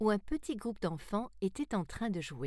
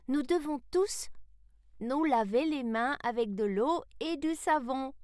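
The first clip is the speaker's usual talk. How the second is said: put-on high boy's voice with a smile; young Salem, smiling; 6 semitones higher, 4 dB louder than usual. A narrator's reading. Salem completes Sarah's advice, high and smiling. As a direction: chime in high and cheerful. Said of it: "le sourire aux lèvres".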